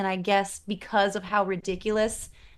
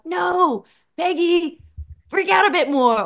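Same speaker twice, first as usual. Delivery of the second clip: higher, louder pleading at the screen; urging; 9 semitones higher, 7 dB louder than usual. Retold or imitated. Viewer urging Peggy on, exasperated louder plea. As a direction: beg the character to react more. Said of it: "where I'm like".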